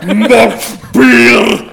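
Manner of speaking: hammed-up dwarf. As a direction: roar for a drink like a film dwarf. Gimli impression.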